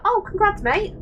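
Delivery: high, offhand congrats mate; breezy congrats. Retold or imitated. To an ex who just came out, breezy high congrats. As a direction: shrug it off with a cheery high congrats.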